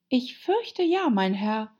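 put-on high-pitched girl character; Alice, earnest and high; polite Alice. A narrator's reading.

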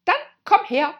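high, very loud royal command; roaring. Acted. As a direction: bellow the summons. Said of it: "brüllte die Königin".

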